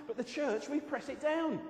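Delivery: loud, high, driving preacher voice; fervent preaching. Acted. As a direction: push it out with full conviction.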